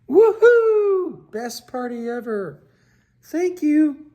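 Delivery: very high, loud whoop of delight; joyful cheer. Acted. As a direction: celebrate the best party ever. Fish cheers at great party.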